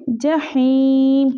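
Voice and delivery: जहीम with the ha breathed from the throat, slowly; throaty ha. Shown as a demonstration. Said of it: "हा को हम हलक से निकालेंगे".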